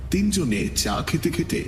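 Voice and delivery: usual speaking voice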